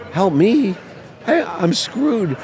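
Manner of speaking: high-pitched, distraught retelling; desperate protest. Retold or imitated. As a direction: wail it in mock despair, dragging the words out. Acts out his desperate reply to mother.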